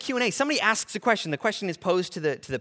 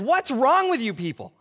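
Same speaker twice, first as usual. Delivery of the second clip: raised, stern rebuke to the crowd; firm rebuke; 7 semitones higher, 4 dB louder than usual. Retold or imitated. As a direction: scold the crowd, a bit louder. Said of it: "he actually looks at the crowd and he says".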